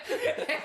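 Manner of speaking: sudden high-pitched laugh; high laugh burst. Real, not acted. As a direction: break into a squeaky laugh. Bursts out laughing mid-story.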